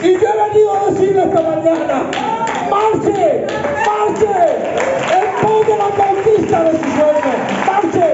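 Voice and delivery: high, slow, shouted exhortation; rally the crowd, drive every word; full-force preaching. Acted. Pastor urging the congregation to march, shouting high.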